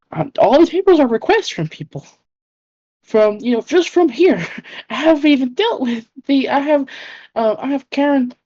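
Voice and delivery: high-pitched, rising frazzled rant; frazzled overwhelm. Acted. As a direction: spiral into overwhelmed panic. Persephone overwhelmed, rising panic.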